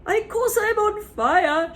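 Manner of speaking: furious granny yell. Acted. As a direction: bellow it indignantly. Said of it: "she yelled".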